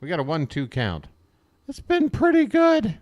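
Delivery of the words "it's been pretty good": "pretty good" in a high squeaky voice; Peter Brady squeak. Shown as a demonstration. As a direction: squeak "It's been pretty good" like a voice crack. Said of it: "my voice just squeaked like Peter Brady".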